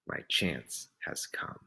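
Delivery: whispered, low and slow; a sly secret thought; inner thought whisper. A narrator's reading. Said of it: "inside he was thinking".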